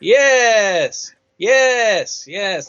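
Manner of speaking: hammy voice, relishing long drawn-out yeses; Matt Berry. Acted. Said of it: "a bit of Matt Berry coming through".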